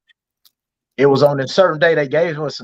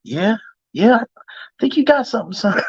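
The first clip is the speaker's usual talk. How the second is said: imitating his dad; 4 semitones higher than usual. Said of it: "He was like".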